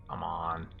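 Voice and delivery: ordinary delivery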